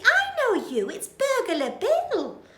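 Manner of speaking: very high, giggling recognition; Betty, thrilled. Acted. Betty recognises Bill from a photo, giggling. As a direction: gush like a fan. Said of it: "says Burglar Betty".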